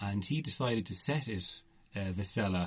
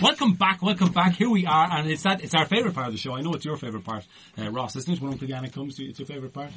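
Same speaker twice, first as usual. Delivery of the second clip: mock-grand show host, higher and louder; hammed-up host; 4 semitones higher, 6 dB louder, faster than usual. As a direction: oversell the segment like a cheesy presenter. Hypes the returning segment.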